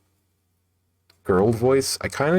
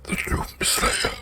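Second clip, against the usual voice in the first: doom-laden movie trailer narrator; alarmist doom warning; 13 semitones higher than usual. Retold or imitated. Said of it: "you sound like Doomsayer".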